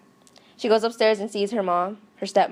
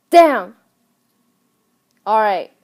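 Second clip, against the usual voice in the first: exasperated dad; 9 dB louder, slower than usual. Retold or imitated. Dad gives in to daughter, loudly exasperated. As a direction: explode on the first word, then relent.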